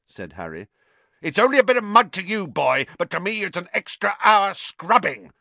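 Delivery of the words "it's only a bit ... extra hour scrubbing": high, loud, fast tirade; angry shout. A narrator's reading. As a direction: shout the boy down in outrage. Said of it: "shouted Filch".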